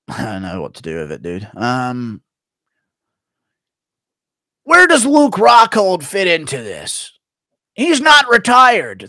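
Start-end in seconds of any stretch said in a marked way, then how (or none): 4.6-9.1 high, loud, slow mock-pundit voice; Chael Sonnen impression. Streamer mocking Chael Sonnen on request, high and slow. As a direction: ham up a punch-drunk fight pundit. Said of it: "Chael Son and Confusion C T E intro impression".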